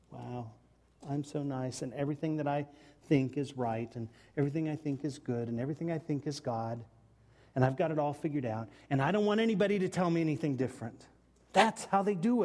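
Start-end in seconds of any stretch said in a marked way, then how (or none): 0.1-11.1 smug self-admiration. Retold or imitated. A self-satisfied church admiring itself, mock smug. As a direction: lower and softer, with a lightly voiced smug rattle.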